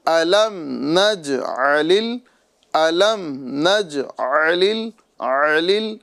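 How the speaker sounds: slow tajweed نجعل ال with distinct ain; clear ain. Shown as a demonstration. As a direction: recite نجعل ال drawn out, squeezing the ع from the throat. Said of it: "عین کی آواز نکالنا".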